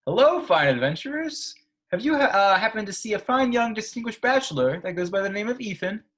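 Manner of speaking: hammy medieval knight voice; mock olde english. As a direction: put on a courtly olde English tone. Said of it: "I'm gonna use my medieval voice for this one".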